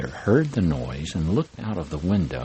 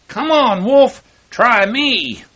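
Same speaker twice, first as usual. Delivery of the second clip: much higher, loud, slow taunt; woodsman challenge; 11 dB louder, slower than usual. Acted. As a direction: dare the wolf to fight, booming.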